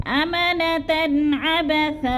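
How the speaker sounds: अमनतन अबसा slowly, drawn out, tajweed style; Quranic recitation. Shown as a demonstration. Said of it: "वक़फ़ नहीं करेंगे तो फिर लफ्ज़ को कैसे पढ़ेंगे". (तजवीद के साथ धीरे धीरे पढ़ना)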